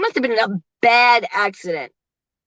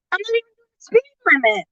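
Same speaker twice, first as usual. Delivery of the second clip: high, offended reply to a backseat driver; indignant retort; 11 semitones higher than usual. Retold or imitated. Answering her nagging husband, high indignant protest. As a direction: bristle at him, much higher. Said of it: "I'm like".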